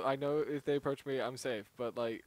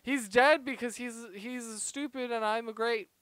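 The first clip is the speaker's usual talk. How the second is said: triumphant boast; 10 semitones higher, 7 dB louder than usual. Pretend. Boasting after a win.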